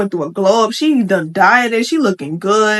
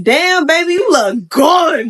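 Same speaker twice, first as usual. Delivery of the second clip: hyped-up, higher-pitched admiration; hyped compliment; 6 semitones higher, slower than usual. Acted. Husband hyping up his dieting wife, hyped compliment. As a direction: gush it, stretching the praise out. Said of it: "can't be giving your wife dry compliments".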